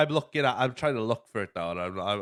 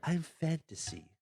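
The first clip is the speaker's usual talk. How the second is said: higher, softer little character voice; lightly voiced Fantasy; 5 semitones higher, 6 dB quieter than usual. Podcaster acting out Fantasy introducing herself, lightly voiced. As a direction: lightly put on a small, higher voice. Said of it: "she becomes this, like".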